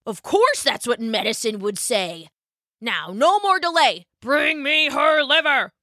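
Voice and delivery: a hint of a domineering king; commanding dragon king. A narrator's reading. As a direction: bark the order, rising.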